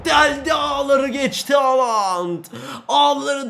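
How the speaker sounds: high, slowed on Haaland; excited commentary. Real, not acted. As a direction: savour the goal, drawn out. Goal celebration commentary.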